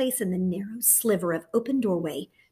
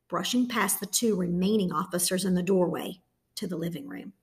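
usual speaking voice

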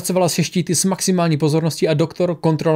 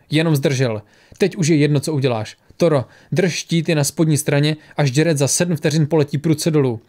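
ordinary delivery

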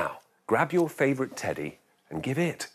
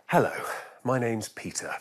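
plain reading voice

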